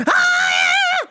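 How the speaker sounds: high loud mock scream. Comic imitating the actress's scream, high mock scream. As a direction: shriek like the dreamer. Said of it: "she ends with a scream".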